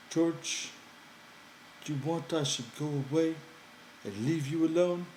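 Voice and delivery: high, eager simple-minded man; Lenny's childlike voice. Acted. Lenny coaxing George by the fire, high and pleading. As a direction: pitch it up high, pleading and earnest.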